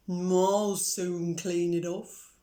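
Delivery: slow steady cow promising help. Acted.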